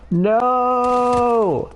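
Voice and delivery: high anguished shout of no; anguished cry. Real, not acted. Watching the customer walk away, anguished high no. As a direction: cry out in despair.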